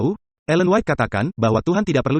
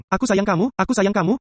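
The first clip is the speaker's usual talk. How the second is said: higher, repeated aku sayang kamu; mock loving reply; 7 semitones higher than usual. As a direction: say it twice, tenderly. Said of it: "Makan pisang".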